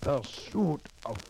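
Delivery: put-on hopeful man in a European immigrant accent. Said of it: "With that accent?".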